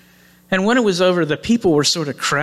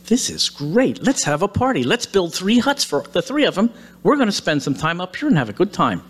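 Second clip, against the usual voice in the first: higher, eager retold enthusiasm; impressed; 5 semitones higher than usual. Simon proposes huts on the mountain, acted excitement. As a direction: act out an excited party planner. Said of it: "he's impressed as well".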